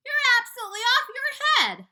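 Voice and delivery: earthworm's shrill outraged cry. Acted. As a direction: shriek it at the boy in outrage. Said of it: "said the earthworm".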